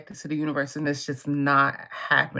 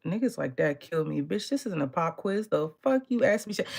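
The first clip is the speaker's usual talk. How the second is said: normal talking voice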